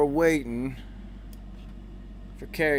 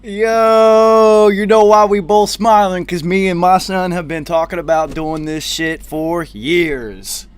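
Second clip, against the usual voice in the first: loud, higher hyped greeting; excited shout; 3 semitones higher, 10 dB louder than usual. Real, not acted. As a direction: shout it out, grinning with joy. Greeting friend, both smiling.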